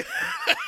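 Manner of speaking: shrill, high laughing fit; real laugh, way up high; squealing laugh. Real, not acted. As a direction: lose it laughing mid-story. Cracking up at own story.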